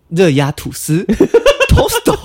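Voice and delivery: hammed-up Japanese accent, breaking into laughter. Retelling his desperate answer to a stranger, mock Japanese accent. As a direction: imitate Japanese intonation on Mandarin, then loanword. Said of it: "日本人讲话有一个腔调".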